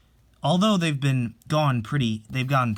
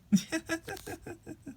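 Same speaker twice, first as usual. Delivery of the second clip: quiet laugh; 8 dB quieter than usual. Acted. Family laughing at the joke.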